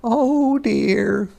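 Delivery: high sighing hedgehog. A narrator's reading. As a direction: sigh it as a tiny hedgehog. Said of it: "sighed Hedgehog".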